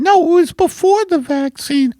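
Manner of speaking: high-pitched put-on wife voice; wife character. Wife corrects her husband about the vaccine, high character voice. Playing wife Margaret in sketch.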